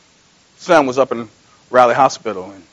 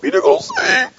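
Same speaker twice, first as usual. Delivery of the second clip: high mocking voice of a disciple; mock the sinking man; 7 dB quieter than usual. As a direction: tease Peter from the boat.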